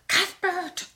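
high mock yell without volume; hammed-up high call; imitated yell. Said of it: "And he yelled".

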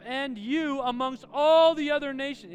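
loud, high preacher's rebuke; forceful rebuke. Acted. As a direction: admonish the people at full force. Moses rebukes the grumbling people.

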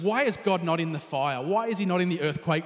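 ordinary delivery

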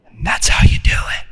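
hushed, voiceless tag line; whispered aside. Acted. Woman sure of her formula, whispering. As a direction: drop to a whisper after the shout.

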